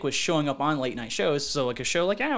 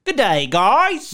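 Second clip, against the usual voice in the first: slow, higher, louder Australian "Good day"; mock Aussie greeting; 5 semitones higher, 8 dB louder, much slower than usual. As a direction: stretch it out like an Aussie.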